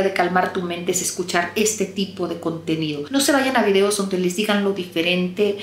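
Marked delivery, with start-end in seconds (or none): none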